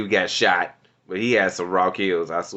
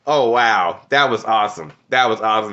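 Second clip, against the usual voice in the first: ordinary talk, nothing marked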